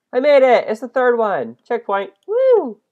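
loud high happy cheer; excited cheer. Real, not acted. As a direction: celebrate the win. Reaches checkpoint.

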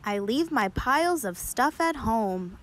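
usual speaking voice

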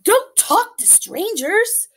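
high, loud, slow warning; urgent chicken warning. Acted. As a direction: jump in and stop her.

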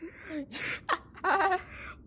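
high startled burst of laughter; shocked laugh. Real, not acted. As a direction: laugh in disbelief. Sudden game ending.

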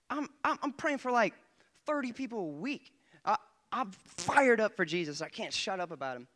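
normal talking voice

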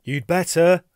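loud, high urgent warning; calling out. A narrator's reading. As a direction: call it out across to the next cell. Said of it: "I called out".

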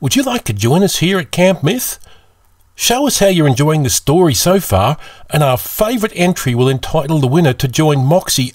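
put-on bright, high young camper voice, pitched well up; a young camper host pitching far above his narrator voice; Felix voice. Young camper Felix inviting listeners to enter the contest, high and eager. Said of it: "Felix here".

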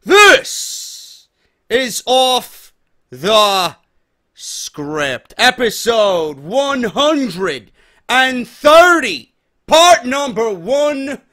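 loud, drawn-out hype host shout; hype announcer intro. Acted. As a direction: bellow it like a wrestling announcer. Hyped show intro announcement.

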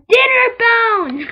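high, loud, slow cry of delight; thrilled shout at finding the item; excited shout. Real, not acted. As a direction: yell it out like a discovery. Calls out item name excitedly.

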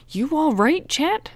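out of breath from running, high and loud; panting. A narrator's reading. Frank, out of breath, checks on his friend panting. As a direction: gasp it out after a sprint. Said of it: "Frank panted".

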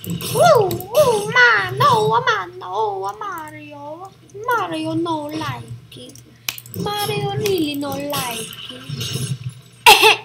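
hammed-up cartoon Italian whine, starting high; drawn-out mock wailing in broken English; Mario. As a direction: play a complaining cartoon plumber. Plays Mario complaining in broken English.

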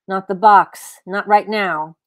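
loud whiny objection; loud protest. Acted. Cat protests loudly as Eleanor brings out the box. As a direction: plead against it in dismay.

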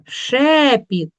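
"Шепіт" with a stretched, punched Е; stress on Е. Shown as a demonstration. Teacher giving the check word, stressed Е. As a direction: hit the first syllable of "Шепіт". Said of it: "під наголосом".

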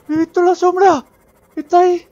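terrified high screaming; being chased. Real, not acted. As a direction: scream for your life. Reaper chasing the sub.

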